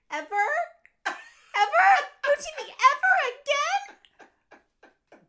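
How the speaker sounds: put-on very high, loud outraged child voice; mother imitating her daughter's horror at no TV, very high and loud; little daughter. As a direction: squeal like a dismayed little girl.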